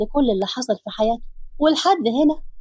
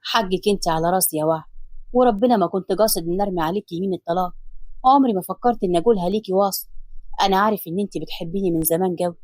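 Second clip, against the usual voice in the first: lower, tender husband's voice; loving man's voice, lower; Hassan's voice; 5 semitones lower than usual. Acted. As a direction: soothe her as her husband, lower.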